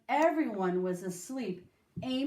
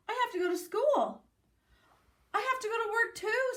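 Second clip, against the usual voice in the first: high, quick family exclaiming; lightly voiced family in dismay; worried family voices; 12 semitones higher, faster than usual. A narrator's reading. Brother and mother exclaiming about school and work, higher.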